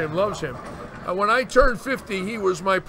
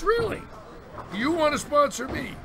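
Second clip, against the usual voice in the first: surprised; 7 semitones higher, 4 dB quieter than usual. Retold or imitated. Told he'll be sponsored, answering in high surprise. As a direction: pitch jumps way up on Really in disbelief.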